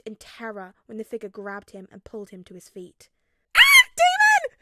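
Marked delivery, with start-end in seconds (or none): 3.6-4.6 very high, very loud slow shriek; terrified scream. A narrator's reading. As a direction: scream in terror. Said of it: "he screamed".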